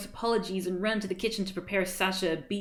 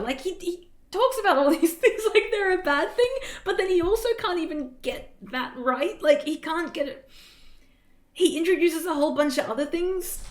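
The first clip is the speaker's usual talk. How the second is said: excited ranting; 9 semitones higher, 5 dB louder than usual. Real, not acted. Reader's amused outraged commentary.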